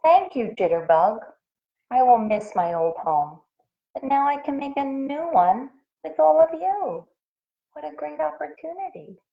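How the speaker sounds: light grateful voice. Acted. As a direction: thank new friends warmly. Pudding grateful.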